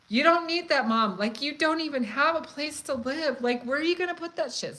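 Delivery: loud, high nagging at mom; scolding mom. Retold or imitated. Daughter scolding her stoned mom in the dollar store, loud and exasperated. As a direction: tell her off for piling up junk. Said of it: "getting on my mom's throat and on her case".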